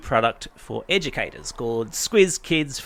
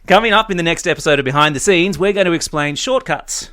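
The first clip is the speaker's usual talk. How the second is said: announcer voice; 5 semitones higher than usual. Acted. Podcast sign-off announcement.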